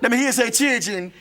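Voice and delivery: high-pitched revival call-and-response; hammed-up Southern preacher; televangelist preacher. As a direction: lead the congregation like an evangelist. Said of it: "a character called the Reverend Dental Virtue".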